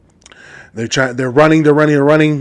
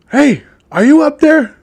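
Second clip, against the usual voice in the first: high-pitched call up a tree; 11 semitones higher than usual. Acted. Police officer hailing a fugitive in a tree, calling up. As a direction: call up to someone hidden overhead.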